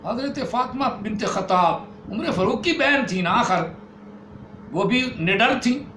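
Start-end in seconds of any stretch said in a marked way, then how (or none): none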